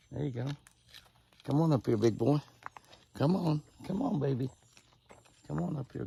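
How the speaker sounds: high, sing-song call to coax the deer in. Real, not acted. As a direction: lure a shy buck closer with a high, friendly call. Coaxing deer to come closer.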